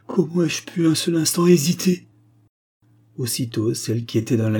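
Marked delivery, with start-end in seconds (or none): 0.1-2.1 high, louder emotional exclamation; swept-up, impassioned cry; impassioned self-reproach. A narrator's reading. Prince holds his beloved after doubting, exclaiming passionately.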